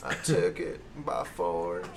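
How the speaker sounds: slow, soft drawled denial; Bill Clinton impression. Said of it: "Bill Clinton".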